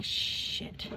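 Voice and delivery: breathy, voiceless swear; mock-dismayed whispered curse. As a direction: whisper it under your breath. Dismayed reaction at red light.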